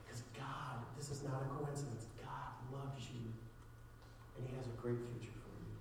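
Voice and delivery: soft, earnest preaching to the room; hushed sincere altar call; quiet sermon appeal. Real, not acted. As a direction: plead gently with the listeners. Quiet closing appeal to audience.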